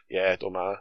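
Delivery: low, soft, unbothered shrug of a reply; blasé. Retold or imitated. Dad dismissing worries over injections, blasé. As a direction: imitate dad brushing it off. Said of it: "just does them so blasé".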